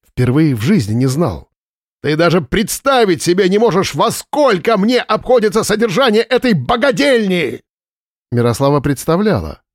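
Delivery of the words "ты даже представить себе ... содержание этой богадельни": angry shouting. A narrator's reading. Boss shouting about costs.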